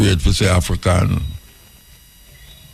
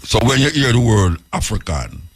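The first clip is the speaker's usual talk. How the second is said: usual speaking voice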